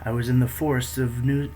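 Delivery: plain reading voice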